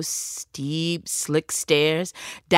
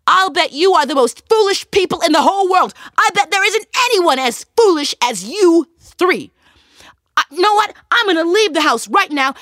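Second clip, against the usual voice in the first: high, loud furious rant; angry tailor; 9 semitones higher, 5 dB louder than usual. Acted. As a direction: shout it high in anger. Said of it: "that tailor was so mad".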